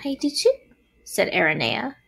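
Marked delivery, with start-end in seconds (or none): 0.0-0.5 high little spider voice. A narrator's reading. Aranea pledges friendship too, small voice.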